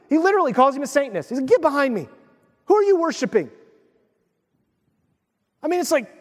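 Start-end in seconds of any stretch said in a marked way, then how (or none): 1.5-3.5 high-pitched retold scolding; pitched-up mock reprimand; harsh rebuke. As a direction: act out a stern challenge. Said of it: "that's kinda harsh".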